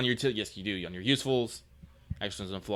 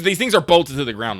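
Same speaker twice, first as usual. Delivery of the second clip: emphatic; 6 semitones higher, 11 dB louder than usual. Real, not acted. GM scolding player's move.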